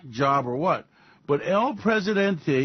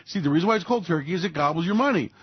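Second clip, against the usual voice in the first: ordinary talk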